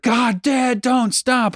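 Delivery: put-on high whiny kids in the back seat. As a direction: whine it like impatient children. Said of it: "they'll be like".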